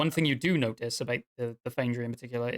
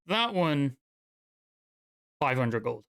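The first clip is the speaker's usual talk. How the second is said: lightly voiced smith naming a steep price; mild dwarf voice; a hint of a haggling dwarf; 3 semitones higher, 4 dB louder, slower than usual. Dwarf overcharges the orc.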